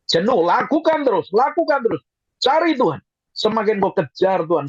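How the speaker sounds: high, insistent preacher's call; urgent exhortation. Real, not acted. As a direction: urge them on relentlessly, pitched high. (seruan mendesak bernada tinggi)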